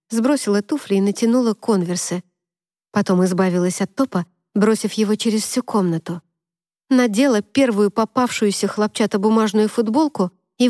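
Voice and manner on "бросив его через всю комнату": plain reading voice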